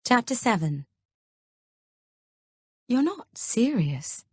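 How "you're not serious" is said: very slow and raised, stunned disbelief; slow, disbelieving half-whisper of shock; slowly. A narrator's reading. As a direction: drag each word out in shock. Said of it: "I say slowly".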